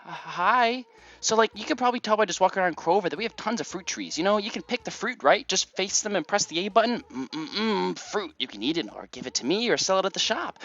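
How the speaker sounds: put-on dumb, girly valley-girl chatter; high, bubbly airhead explaining things; Rosie. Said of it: "as dumb and girly as a voice as Rosie".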